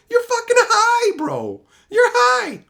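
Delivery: laughing high squeal through the words. Real, not acted. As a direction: crack up as you tell him off. Mocking boss, laughing.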